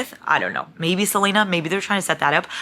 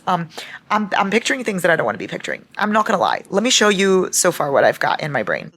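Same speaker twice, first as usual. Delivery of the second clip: ordinary talk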